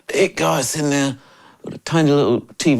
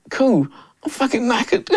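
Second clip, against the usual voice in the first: high, laughing about being wiped out; giggly, worn-out complaint; laughing exhausted; 10 semitones higher than usual. Retold or imitated. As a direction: laugh through the tiredness.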